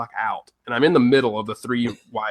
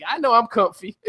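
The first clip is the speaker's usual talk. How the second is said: sweet seatmate; 10 semitones higher than usual. Retold or imitated. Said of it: "she's like".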